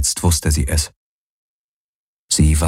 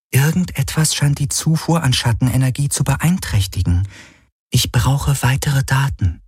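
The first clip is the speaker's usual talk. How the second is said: higher, measured computer-like voice; higher-pitched, detached analysis; shadow helix, analytical; 7 semitones higher than usual. Acted. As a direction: deliver a diagnosis in a higher voice.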